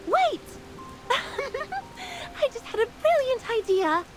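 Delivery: high-pitched burst with a delighted laugh; excited brainwave. Acted. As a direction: break in eagerly, laughing at your own sudden idea. Fairy suddenly gets a brilliant idea.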